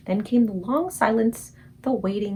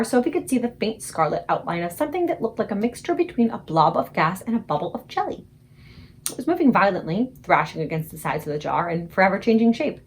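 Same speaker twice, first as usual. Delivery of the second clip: usual speaking voice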